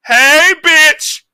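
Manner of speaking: high, loud crude shout of "bitch"; mock loud catcall. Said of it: "It went from that to".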